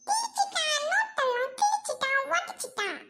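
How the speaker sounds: put-on very high whiny child voice; wailing child complainant. Schoolchild complains of torn notebooks, high wail. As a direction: whine out each accusation.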